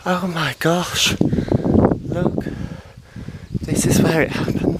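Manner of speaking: thrilled at finally standing on the spot; real excitement arriving at the Sound of Music hill; excited awe. Real, not acted. Reaching the famous film spot.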